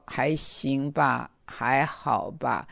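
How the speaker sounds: slow, soft and low, dejected; 垂头丧气. Retold or imitated. Giving the elder the same answer daily, downcast and slow. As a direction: drag out each half-hearted answer. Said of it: "很垂头丧气的".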